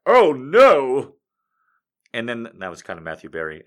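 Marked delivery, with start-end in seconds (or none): 0.1-1.2 lightly voiced scared prince; a hint of hammy fright; mock alarmed hero. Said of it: "he's all like".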